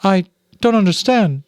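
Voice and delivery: high, bewildered mother mouse; Mrs Frisby puzzled. Acted. Mother mouse to the rats, high and bewildered. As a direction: sound lost, pleading to understand.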